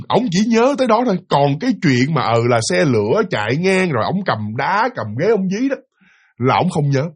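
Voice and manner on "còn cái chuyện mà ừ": ordinary delivery, nothing marked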